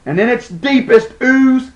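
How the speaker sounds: high-pitched, deliberate theatrical menace; vengeful declamation. Acted. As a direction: pronounce the threat as a ringing curse. Threat against Rome continues.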